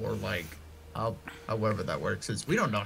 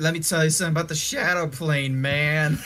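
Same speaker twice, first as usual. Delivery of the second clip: shady dealer; 4 semitones higher, 6 dB louder than usual. Acted.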